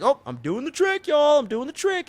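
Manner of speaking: high-pitched mock excited showman; hammed-up excited boast; showing off. Acting stage magician showing off trick.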